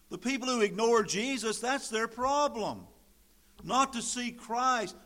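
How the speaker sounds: high, loud, driving pulpit voice; preacher hammering the point home; full-force preaching. Acted. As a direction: build to a thundering climax. Preaching rises to climax.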